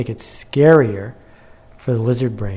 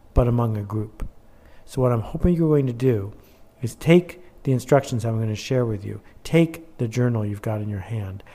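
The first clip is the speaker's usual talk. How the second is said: ordinary talk, nothing marked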